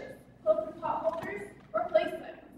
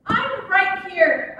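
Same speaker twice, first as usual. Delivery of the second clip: loud, drawn-out insistence; calling attention; 2 semitones higher, 9 dB louder, much slower than usual. Acted. Woman waves at lover who wants to see her, calling attention. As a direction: slowly call out to be noticed. Waving to get Bob's attention.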